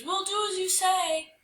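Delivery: high-pitched creature chorus voice; obedient calling. Acted. Creatures promising the spirit to obey, calling out high. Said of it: "called the creatures".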